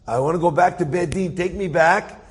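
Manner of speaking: imitating a man begging an angel; retold begging. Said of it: "I started begging the angel".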